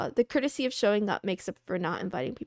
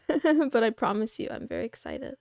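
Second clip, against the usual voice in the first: usual speaking voice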